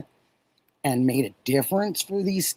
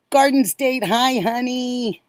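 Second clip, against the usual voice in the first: warm greeting; 7 semitones higher than usual. Real, not acted. Greeting a viewer in chat.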